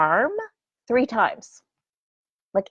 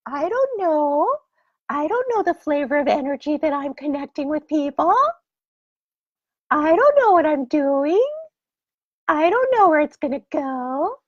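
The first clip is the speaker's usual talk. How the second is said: clueless whine; 7 semitones higher than usual. Retold or imitated. Mock clueless self quoted.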